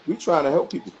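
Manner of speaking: usual speaking voice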